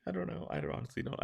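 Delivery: ordinary delivery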